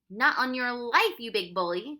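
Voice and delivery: put-on loud, defiant boy; fierce retort. A narrator's reading. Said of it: "Tom whispered fiercely".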